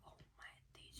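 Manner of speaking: breathless whispered shock. Retold or imitated. Telling friends about the test, stunned whisper. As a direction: whisper it in disbelief.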